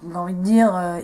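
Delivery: usual speaking voice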